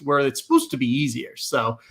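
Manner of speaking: ordinary talk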